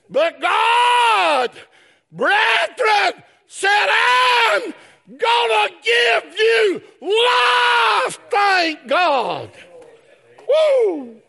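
whooping preacher climax; preaching at full force. Real, not acted. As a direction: ring it out, stretching "life". Preacher shouts God's promise.

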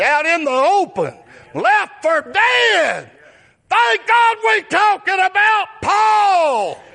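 slow, high, loud shout; preaching at full force. Acted. Preacher telling of Paul's suffering, shouting slowly. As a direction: stretch it out in a shout.

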